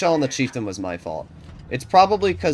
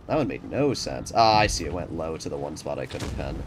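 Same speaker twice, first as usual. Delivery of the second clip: normal talking voice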